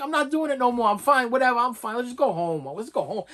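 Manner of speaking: high, whiny retold protest; fed-up refusal. Husband telling his wife he just wants to leave, fed up.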